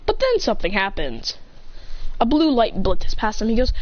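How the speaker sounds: plain reading voice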